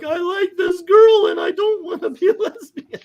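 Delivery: loud, high-pitched imitated wail; hammed-up bawling confession; mock crying. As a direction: wail it out like a caricature of sobbing. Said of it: "she is crying her eyes out".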